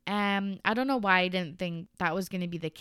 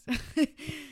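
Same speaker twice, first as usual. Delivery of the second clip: short laugh; 7 semitones higher than usual. Real, not acted. Laughing about covid times.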